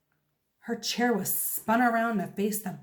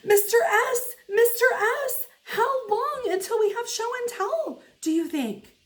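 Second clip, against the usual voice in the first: put-on high, loud kid calling out; shouted; 12 semitones higher, 4 dB louder than usual. A narrator's reading. Junie B shouting at her teacher from her desk. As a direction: yell across the classroom for the teacher. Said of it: "I stood up and shouted".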